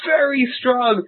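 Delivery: retold giddy shout of hurrah; fanboy cheering. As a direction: cheer like a kid meeting his hero. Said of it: "And I was like".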